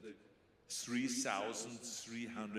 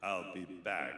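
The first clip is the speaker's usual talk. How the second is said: louder, lower robotic delivery; Terminator voice; 4 semitones lower, 6 dB louder than usual. Shown as a demonstration. Fan asks for the movie line, robotic Terminator. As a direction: say it like the machine in the movie. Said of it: "the way you said it in the movie".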